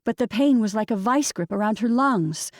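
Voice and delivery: usual speaking voice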